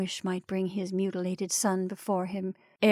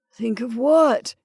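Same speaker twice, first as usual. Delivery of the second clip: questioning; 3 semitones higher, 6 dB louder, slower than usual. A narrator's reading. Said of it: "he questioned".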